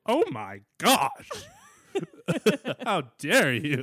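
high, loud, drawn-out mock outrage; hammed-up motherly affront; scandalized fifties mom. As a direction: play a shocked old-fashioned mother. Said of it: "taken aback like I was a nineteen fifties mom".

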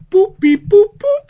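high, loud beeping noises; robot beeps. Retold or imitated. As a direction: beep like a little robot. Imitating ship beeps.

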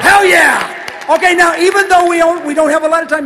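high, very loud holler; exuberant shout. Acted. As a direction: belt it out to the crowd. Hollering back at a fast-talking fan.

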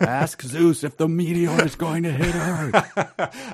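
high, drawn-out hammy wail; mock panicked yell. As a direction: yell it slowly in exaggerated panic, near laughter. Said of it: "that could be a panicked yell".

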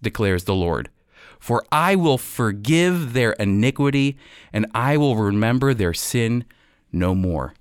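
ordinary talk, nothing marked

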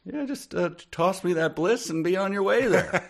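high-pitched eager dog; squeaky, eager dog voice; high silly dog voice. Pretend. Mock dogs grabbing the bliss.

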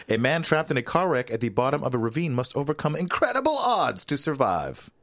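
high, building movie-trailer delivery; hammed-up film promo, pitch climbing; dramatic tagline read. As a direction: sell it like an overwrought trailer. Reads the film's tagline dramatically.

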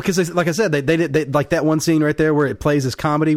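ordinary delivery

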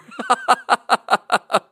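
high staccato laugh. Real, not acted. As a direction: burst into a high, rapid laugh. Laughs at his own joke.

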